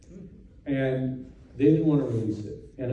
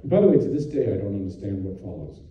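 ordinary talk, nothing marked

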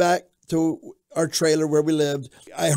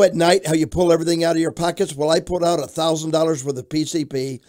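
usual speaking voice

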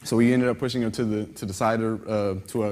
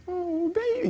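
tender comfort; slower than usual. Retold or imitated. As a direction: soothe him like a worried wife. Wife comforts crying husband.

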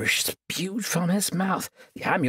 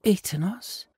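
sweet, higher and innocent; angel voice; 6 semitones higher than usual. Acted. Sorceress faking an angel's voice to lure her captive, sweetly. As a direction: coax him like a gentle angel. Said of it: "in the voice of the angel Illyria".

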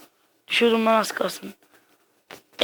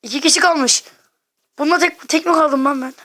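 higher, louder alarmed call; louder, higher panicked disbelief; tense excitement; 5 semitones higher, 4 dB louder than usual. Real, not acted. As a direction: rush it out on edge. Tense endgame, two players left.

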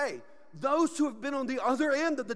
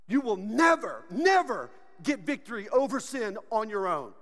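ordinary delivery

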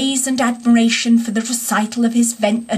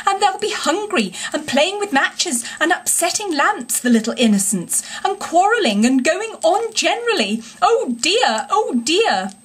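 higher, mild mock-tearful wail; sobbing; 6 semitones higher than usual. A narrator's reading. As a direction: pile on the pity, lightly sobbing. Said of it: "sobbed Toad".

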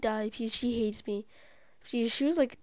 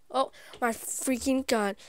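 usual speaking voice

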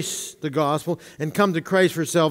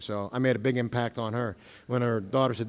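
ordinary talk

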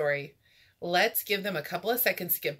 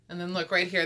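usual speaking voice

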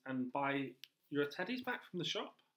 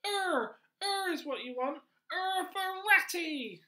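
much higher, louder squeaky rat; rat character; 7 dB louder, slower than usual. Acted. Teacher voicing the pet-shop rat to children, high and loud. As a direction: play the pushy little rat, high and loud.

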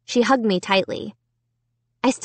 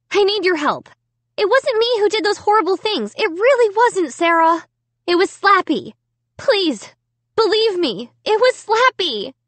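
desperate pleading; 11 semitones higher, 3 dB louder than usual. A narrator's reading.

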